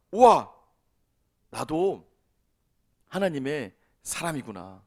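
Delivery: loud wow, then low and slow; awed realization. Acted. Believer realizing they belong to God, in acted awe. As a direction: burst out, then sink into slow wonder.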